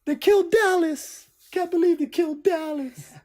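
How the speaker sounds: high, drawn-out cry of disbelief; mock childhood wail. Reenacting his kid self at Dallas's death, hammed-up wail. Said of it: "I remember being a kid going".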